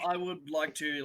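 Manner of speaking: ordinary delivery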